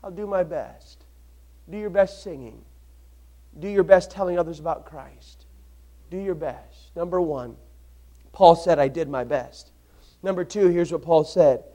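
soft, low intimate preaching; hushed preaching. Acted. Preacher leaning in to the congregation, soft and hushed. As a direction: draw them in with a near-whisper.

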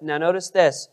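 normal talking voice

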